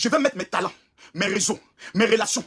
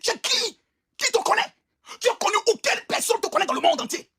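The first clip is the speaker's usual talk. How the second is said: outraged challenge; 8 semitones higher than usual. Acted. Ranting at the coach in outrage.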